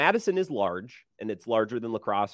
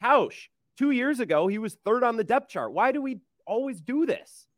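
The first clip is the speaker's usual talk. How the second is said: exasperated reply; 10 semitones higher than usual. Retold or imitated. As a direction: plead with him, pitch up. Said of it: "And I'm like".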